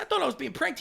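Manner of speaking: high squeaky protest. Retold or imitated. Telling cop he felt pranked.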